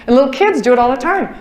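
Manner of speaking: usual speaking voice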